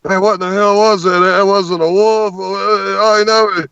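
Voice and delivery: high, fast, astonished outburst; excited cameraman. Retold or imitated. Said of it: "I hear the cameraman back there".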